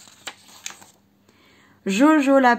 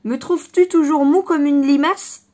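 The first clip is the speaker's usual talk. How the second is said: louder mocking cry; 3 semitones higher, 6 dB louder than usual. The bucking horse cries out to the fox, louder. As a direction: shout it while bucking. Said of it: "s'écriait le calme Pompon".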